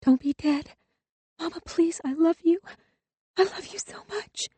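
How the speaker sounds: very high, soft, breaking voice; girl whispering to her dead mother; tearful whisper. A narrator's reading. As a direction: plead with the dead mother through tears. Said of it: "She whispered".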